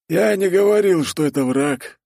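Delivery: high, insistent dwarf; dwarf Torgvald, clarifying. Acted. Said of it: "уточнил он".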